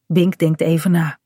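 ordinary delivery

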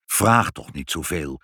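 ordinary delivery, nothing marked

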